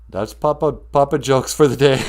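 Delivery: ordinary talk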